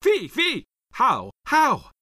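high, loud outraged cries; angry yell. A narrator's reading. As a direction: yell in shock and anger at the aides. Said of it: "He yelled to his aides".

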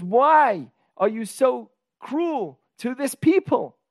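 high, slow, drawn-out plea; hard protest. Acted. Moses asks God about the suffering people, hard protest. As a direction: stretch each accusing word, pitched high. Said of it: "hard words to God".

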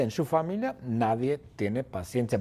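ordinary delivery, nothing marked

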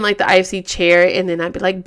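ordinary talk

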